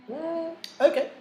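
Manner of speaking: a high, sarcastic mm okay; high mock acceptance. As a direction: brush off the excuse with a mock-sweet okay. Teacher mocking student excuse.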